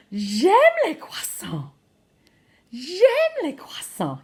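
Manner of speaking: slow, high, delighted French; gushing. Shown as a demonstration. Teacher modelling a love of croissants, gushing. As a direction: draw it out with relish.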